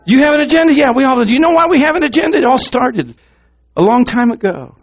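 high, loud preacher's challenge; full-force preaching. Acted. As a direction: call it out to the whole congregation.

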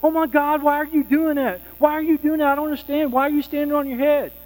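high-pitched, frantic mock shriek; hammed-up panicked yelling; acted screaming. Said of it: "She starts screaming".

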